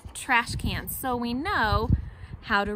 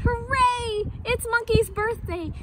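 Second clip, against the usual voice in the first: loud, high cheer; cheering animals; 9 semitones higher, 6 dB louder, slower than usual. Acted. All the animals cheer for Monkey, loud joyful shout. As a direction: shout it out in celebration. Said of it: "all the animals yelled".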